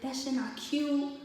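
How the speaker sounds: retold disgusted telling-off; imitated going off. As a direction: imitate dad scolding, drawing it out. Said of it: "He started going off".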